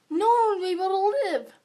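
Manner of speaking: loud, high, hammed-up despair; mock-dramatic wail. Girl joking to viewers about life without money, mock wail. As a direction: wail it as if it were a catastrophe.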